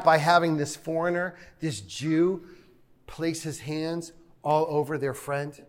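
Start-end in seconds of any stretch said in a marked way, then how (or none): none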